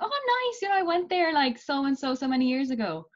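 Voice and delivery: high-pitched impressed small talk; delighted stranger making chat; friendly enthusiasm. Retold or imitated. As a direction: react brightly, pitched well up. Acting out friendly reaction abroad.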